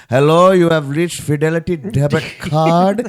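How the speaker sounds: hammed-up Indian phone agent; Indian accent. Comic plays a debit card call center agent, mock Indian accent. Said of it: "use that accent".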